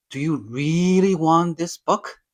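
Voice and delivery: lifted, stretched "really" full of excitement; excited question. Shown as a demonstration. Teacher demonstrating an English elongated vowel, excited. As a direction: ask it like you can hardly believe it. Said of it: "to show some excitement".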